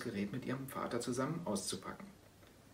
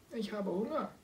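high, piping little cat voice; squeaky kitten voice; 9 semitones higher than usual. Acted. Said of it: "piepste es". (hohe piepsige Kätzchenstimme)

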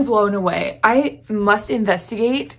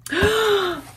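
excited gasp; 10 semitones higher than usual. Real, not acted. Opening a surprise gift.

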